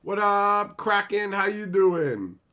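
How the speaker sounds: loud, high, drawn-out greeting; calling out. Real, not acted. Streamer greets an arriving viewer, calling out. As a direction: holler it high and stretch the last words.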